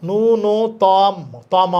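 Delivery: "ন্যূনতম" dragged out as nu-no-tawm; wrong ending. Shown as a demonstration. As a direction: stretch the wrong last syllable "তম". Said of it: "এটা হচ্ছে না".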